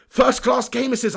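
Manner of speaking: usual speaking voice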